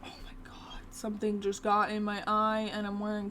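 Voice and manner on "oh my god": whispering. Real, not acted. Something in her eye.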